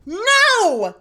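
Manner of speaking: high, loud frightened yell; scared scream. Real, not acted. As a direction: yelp in fright.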